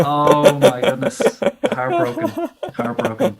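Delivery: high-pitched, laughing mock dismay; hammed-up, drawn-out disbelief; mock heartbreak. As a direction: play wounded through laughter. Jokingly hurt that co-host didn't know.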